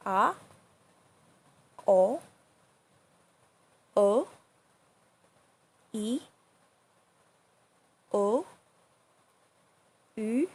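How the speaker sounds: A, o, o, e, o, u each starting mid and swooping up; A, o, o, e, o, u with pitch climbing to the top; rising second tone. Shown as a demonstration. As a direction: model A, o, o, e, o, u like short questions. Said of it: "Start your voice in the middle".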